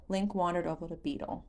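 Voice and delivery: plain reading voice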